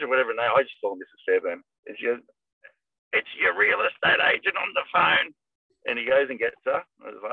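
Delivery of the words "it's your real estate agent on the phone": high, odd put-on old man voice; raspy old husband. Old husband calls his wife to the phone, raspy put-on voice. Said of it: "he's sort of got a really raspy voice".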